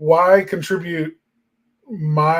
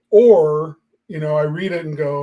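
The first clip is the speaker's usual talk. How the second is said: ordinary delivery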